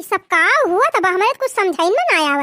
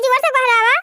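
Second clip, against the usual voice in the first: normal talking voice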